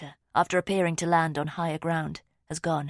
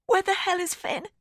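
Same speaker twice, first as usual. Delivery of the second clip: husky; 3 dB louder than usual. A narrator's reading. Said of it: "my voice husky".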